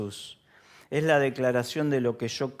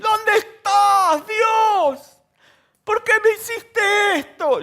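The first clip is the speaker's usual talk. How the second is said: much higher, loud slow wail to God; mock desperate shout; 10 dB louder, slower than usual. Preacher imitating someone screaming at God, loud and high. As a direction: cry out to heaven in mock despair. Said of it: "nunca escuchaste que le dice".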